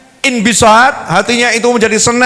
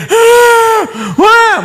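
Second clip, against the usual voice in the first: high, drawn-out mock howl; imitated older child crying; 13 semitones higher, 4 dB louder than usual. Preacher imitates a bigger child crying, mock howling. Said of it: "yang sudah agak gede".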